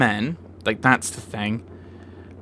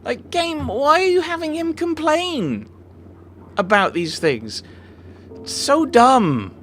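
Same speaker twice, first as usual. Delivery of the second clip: very high, drawn-out exasperated rant; frustrated at the game; 6 dB louder, slower than usual. Real, not acted. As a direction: complain at the game in a slow, high-pitched wail. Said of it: "a game I'm getting frustrated with".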